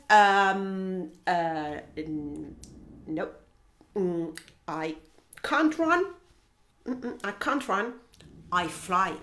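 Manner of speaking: low, halting refusal; hesitant flamingo. Acted. Flamingo answering hippo's race idea, low and reluctant. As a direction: stall in a low voice, then decline. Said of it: "But Flamingo says".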